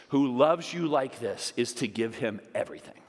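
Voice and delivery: ordinary delivery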